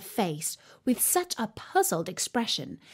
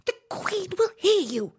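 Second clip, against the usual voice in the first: high, anxious warning; frightened rabbit; 11 semitones higher than usual. A narrator's reading. Rabbit warning Alice in fright. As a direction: warn her in fright. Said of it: "in a frightened tone".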